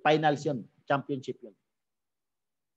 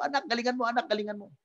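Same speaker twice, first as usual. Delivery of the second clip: cheering father; 5 semitones higher, 4 dB quieter than usual. Retold or imitated. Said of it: "sigaw na sigaw".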